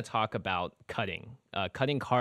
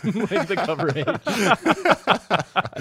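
laughing; 11 semitones higher, 8 dB louder, much slower than usual. Real, not acted. Laughs about wearing faces shirt.

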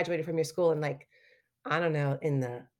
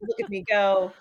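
hammed-up gushing actress. Gushing actor self-parody.

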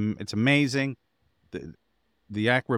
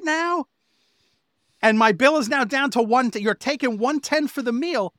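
1 outraged rant; 5 dB louder than usual. Retold or imitated. Husband ranting at casino restaurant manager, outraged. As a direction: let the outrage boil over at the manager.

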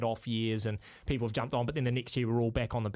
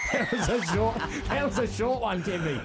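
laughing; 8 semitones higher, 4 dB louder than usual. Real, not acted. Laughing at short segment.

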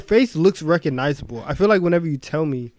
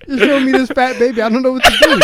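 laughing plea; 7 semitones higher, 5 dB louder than usual. Real, not acted. Helpless laughing defence.

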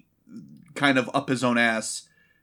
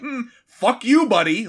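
higher, louder, drawn-out brush-off; mock spite; 8 semitones higher, 5 dB louder than usual. Liu Kang throwing the suitcase in water, mock-spiteful. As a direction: slowly relish the mock insult.

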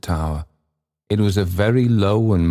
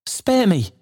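high-pitched, frightened plea; Trotty. A narrator's reading. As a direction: cry out for mercy, pitched far up. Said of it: "cried Trotty, falling on his knees".

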